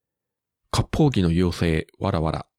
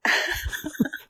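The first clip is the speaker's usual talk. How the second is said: high laugh; 4 dB quieter than usual. Real, not acted.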